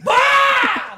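very high, loud burst; sudden shout. Pretend. Startling the neighbour with a high loud shout. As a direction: blurt it out as a yell.

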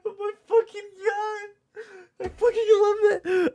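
high, cracking voice through real laughter; helpless laughter. Real, not acted. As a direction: laugh so hard the words squeak out. Cracking up at the clip.

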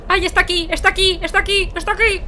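high, repeated panicked cries; pánico. Real, not acted. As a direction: cry out in alarm, over and over. Monster has found the player. (gritos agudos de pánico)

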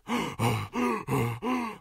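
breathless gasps; wolf panting. Acted. Wolf out of breath from the shortcut, panting. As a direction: pant like after a run. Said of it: "a little out of breath".

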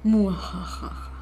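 slow evil villain cackle; mad scientist. Pretend. Evil laugh at night start.

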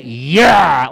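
loud, drawn-out snatching growl; imitated grab. Comic showing falcons won't let go, clutching noise. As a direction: mimic talons gripping and holding. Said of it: "like that".

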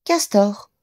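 ordinary delivery, nothing marked

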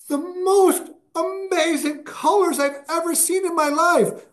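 high, amazed voice imitating her wonder; awestruck woman. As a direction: gush about the colors like she's dazzled. Woman describing her vision, big smile.

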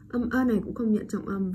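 normal talking voice

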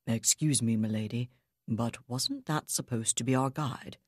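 low, rumbling bodyguard voice; deep rumbling voice. Acted. Bodyguard questions Abigail from behind, deep rumble. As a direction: let it roll up from low in the chest. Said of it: "A deep voice rumbled behind her".

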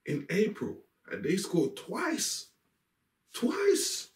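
high-pitched, incredulous outburst; exasperated rant. Real, not acted. Exasperated rant at conceding at home.

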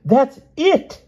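very high, loud triumphant cry; delighted exclamation. A narrator's reading. Pellinore delighted the queen was guessed, high and loud. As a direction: burst out with delight. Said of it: "he exclaimed".

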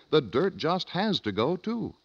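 usual speaking voice

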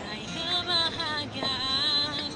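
ordinary talk

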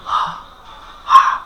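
হা as voiceless throat friction only; whispered mid-throat h; bare h sound. Shown as a demonstration. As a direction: push out the breath of হা without the vowel. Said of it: "এই শব্দটা বের করো".

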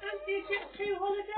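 high-pitched holiday pitch; teasing high put-on voice; mock advert singsong. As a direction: chirp it like a silly advert. Mock advert line repeated.

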